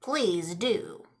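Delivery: low, soft ghoul growl; growled reply. Acted. Ghoul dares the old man in a low soft growl. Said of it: "growled the ghoul".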